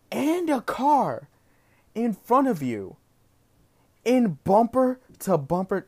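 outraged disbelief at her letting trucks in; indignant rant. Real, not acted. Indignant rant about merging.

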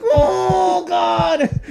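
high, drawn-out panicked cry; freaking out. Retold or imitated. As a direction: wail it in mock terror. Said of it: "I was freaking the fuck out".

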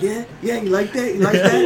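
high, pushy mock taunt; taunting friends. Friends taunt him while he is getting dumped, high and goading.

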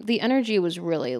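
ordinary delivery

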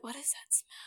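hushed, voiceless aside; whispered disgust. Retold or imitated. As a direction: whisper it as a private reaction to a stink. Retold reaction to a stink.

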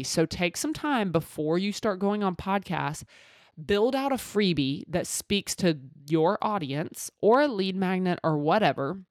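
usual speaking voice

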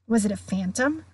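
usual speaking voice

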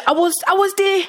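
lightly voiced boasting father; dad voice. Dad voiced bragging in story.

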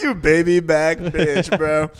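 slow mock insult. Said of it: "I'm like".

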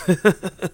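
high chuckle; short, high-pitched burst of laughter. Real, not acted. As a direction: laugh at your own reaction. Laughs after own remark.

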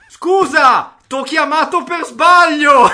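urlo alto dal vivavoce. Retold or imitated. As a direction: grida come uscita da un telefono a tutto volume. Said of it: "una potenza inaudita, in viva voce". (voce dal vivavoce a tutto volume)

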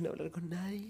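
whispered secret demand; coy whisper of a jealous request. Retold or imitated. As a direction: breathe it out, unvoiced and close. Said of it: "Y yo le dije".

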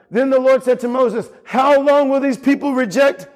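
preaching. Acted. Preaching scripture.

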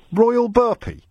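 can this really be his name; incredulous. Real, not acted. Host asks the caller about the name, incredulous.

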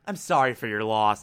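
very low, soft and quick; retold cold condolence. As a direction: toss off condolences quietly, deep in pitch.

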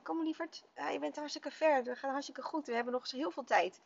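retold motherly encouragement; encouraging. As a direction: cheer a little one on gently. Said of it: "een beetje aangemoedigd".

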